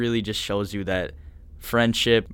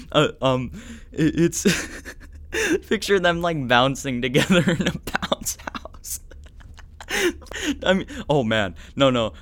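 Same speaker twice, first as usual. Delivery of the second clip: higher voice breaking up into real laughter; helpless laughter; 7 semitones higher than usual. Real, not acted. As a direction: laugh through the words, losing the fight. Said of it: "trying so hard not to laugh".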